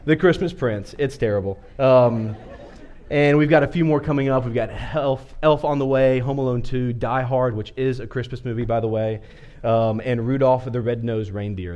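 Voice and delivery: ordinary talk, nothing marked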